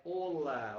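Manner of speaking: Olá said with no h sound at all; teacher demonstrating Olá; silent h olá. Shown as a demonstration. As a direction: open straight on the vowel of Olá. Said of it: "é com H".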